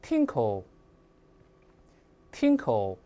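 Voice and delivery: tinkle said slowly as TING-kul; two separate, careful takes of tinkle; clear model pronunciation. Shown as a demonstration. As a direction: model tinkle for students to repeat. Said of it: "那我们大声来读一下".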